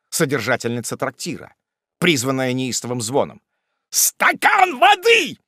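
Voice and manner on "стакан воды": very high, drawn-out exasperated yell; irritable shout. A narrator's reading. Hot-tempered Wardle echoes Pickwick's call, irritable shout. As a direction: bellow the demand, stretched and impatient. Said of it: "кричал раздражительный Уардаль".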